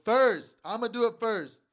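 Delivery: excited vow. Real, not acted. Vowing to rush the field.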